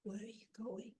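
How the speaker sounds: weak, softened, whispery sickly voice; frail storybook tree with little breath; thin, unhealthy voice. A narrator's reading. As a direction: ask it feebly, half whispered. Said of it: "in a very thin, unhealthy voice".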